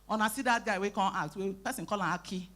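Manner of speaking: ordinary delivery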